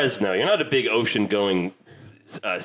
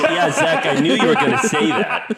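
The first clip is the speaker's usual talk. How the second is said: loud, teasing taunt voiced for a friend; loud, triumphant mock boast; gloating imitation of co-host; 3 semitones higher, 5 dB louder than usual. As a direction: crow it over the laughter. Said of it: "That's her saying".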